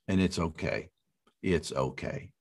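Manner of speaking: low, soft comforting tone; gentle reassurance. Real, not acted. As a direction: let the voice drop to a tender hush. Reassuring couples about hard days.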